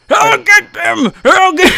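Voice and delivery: high, loud, slow dwarf yell; dwarf shouting vengeance; delirious dwarf shouting. Acted. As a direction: bellow like a dying dwarf warrior. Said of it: "and starts to shout".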